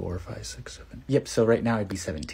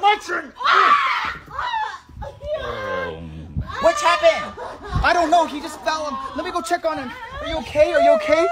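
put-on very high child voice, concerned; high little girl voice; 5 dB louder than usual. Young girl checking on a hurt friend, in a very high voice. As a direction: squeak it like a small girl.

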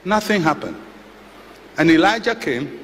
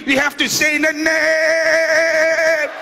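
high, drawn-out shouted 'In the name'; mock preacher scream; slower than usual. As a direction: stretch out 'name' in a yelled preacher cry. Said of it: "You have to scream".

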